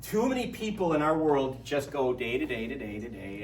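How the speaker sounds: ordinary talk, nothing marked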